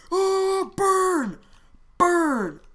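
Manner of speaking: high, loud, drawn-out yell; loud gaming outburst; gleeful battle shout. Real, not acted. Cheering a flamethrower attack in game.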